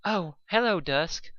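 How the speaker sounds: a shy pony greeting a visitor; timid Fluttershy. A narrator's reading. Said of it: "Fluttershy said timidly".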